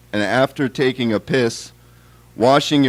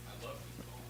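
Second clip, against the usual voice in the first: real whisper, off to the side; whispered aside; 30 dB quieter, much faster than usual. Real, not acted. Poet whispering an aside between poems, real whisper. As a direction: murmur it just for yourself.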